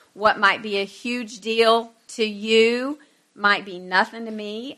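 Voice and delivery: usual speaking voice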